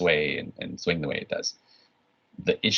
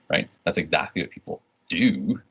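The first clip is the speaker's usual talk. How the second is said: ordinary delivery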